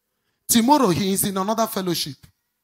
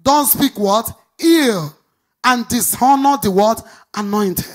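louder and higher, slow emphatic lines; preaching at full force; 4 semitones higher, 6 dB louder, slower than usual. Acted. Pastor warning the congregation against dishonor, preaching loudly and slowly. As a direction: drive every word home. Preacher drives home points five and six.

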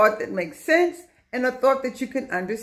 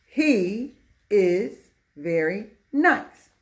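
slow, every word set apart; word-by-word reading; 2 semitones lower, much slower than usual. Shown as a demonstration. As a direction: read it out one word at a time. Teacher rereads corrected example word by word.

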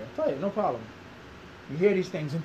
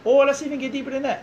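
said higher and louder, pushing further; 6 semitones higher, 5 dB louder than usual. Real, not acted. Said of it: "repeated line, first take louder".

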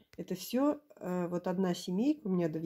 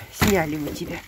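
whisper; 5 semitones lower, 4 dB louder than usual. Real, not acted. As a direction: whisper it tenderly. Whispered to the goat.

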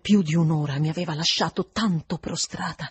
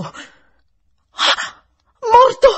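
gasp, then high loud cry; almost shriek it in shock; near scream; 10 dB louder, much slower than usual. A narrator's reading. Governess hearing of the death, almost screaming. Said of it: "quasi gridai".

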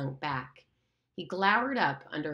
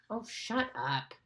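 slow, weary brush-off; tired, annoyed Ralph; irritated dismissal; 6 semitones higher, 3 dB quieter, slower than usual. Acted. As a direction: wave Piggy away.

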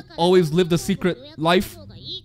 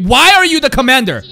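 loud, raised-pitch demand; baffled shout; 4 semitones higher, 11 dB louder than usual. Real, not acted. Viewer questioning the new commander, baffled shout. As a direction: blurt it out loudly in disbelief. Baffled outburst at plot twist.

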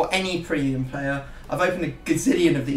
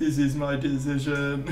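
robot impression; 3 semitones lower than usual. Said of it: "You make me sound like a robot".

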